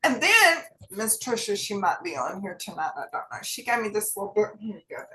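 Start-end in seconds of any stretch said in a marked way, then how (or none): none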